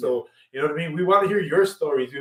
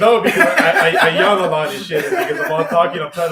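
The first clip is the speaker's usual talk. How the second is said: laughing; 2 semitones higher, 6 dB louder than usual. Real, not acted. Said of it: "fight back the yawns".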